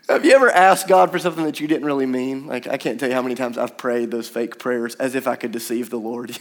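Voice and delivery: ordinary delivery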